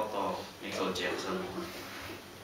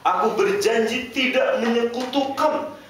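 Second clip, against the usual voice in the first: fervent vow; 12 semitones higher, 7 dB louder than usual. Real, not acted. Preacher voicing the pilgrims' vow to Allah, high and loud. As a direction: proclaim the vow with full force.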